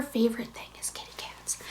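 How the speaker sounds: soft unvoiced whisper. Real, not acted. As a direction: hush so the dog won't hear. Whispers about the dog.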